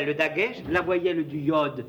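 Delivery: ordinary delivery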